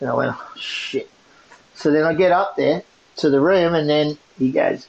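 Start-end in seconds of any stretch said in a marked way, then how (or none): 0.4-1.1 breathed, almost silent curse; whispered dread. Retold or imitated. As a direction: mutter it under your breath in fear.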